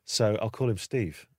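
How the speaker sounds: normal talking voice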